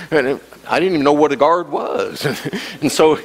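ordinary talk

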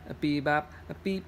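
ordinary delivery

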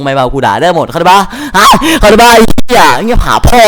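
heated taunting. Real, not acted. Angry trash talk in voice chat.

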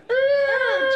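pterodactyl shriek. Shown as a demonstration. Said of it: "pterodactyl noise".

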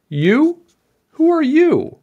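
very high, loud questioning king; incredulous king. A narrator's reading. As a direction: squeal it in disbelief. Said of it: "asked the king".

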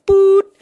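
sudden high loud burst; loud yelp. Pretend. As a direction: blurt it out sharply. Sudden loud noise punchline.

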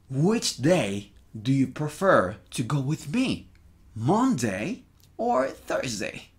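careful, drawn-out English for learners; slow model reading. Shown as a demonstration. Teacher reading the translated question to students, slowly. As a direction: spell out the question slowly, phrase by phrase.

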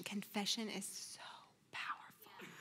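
fading into a whisper; soft, deeply moved emphasis; awed hush. Real, not acted. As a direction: let it drop to a reverent whisper. Moved preacher stresses confession's power.

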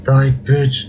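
ordinary talk, nothing marked

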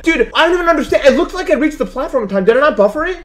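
high, loud disbelief at the game; real frustration, pleading with the screen; shrill-edged gamer complaint; exasperated outburst. Real, not acted. Fails again, exasperated.

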